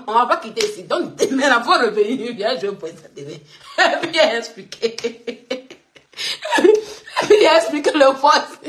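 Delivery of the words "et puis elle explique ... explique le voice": teasing with laughter in the voice; amused, breaking up between phrases; giggling. Real, not acted. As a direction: laugh through the words.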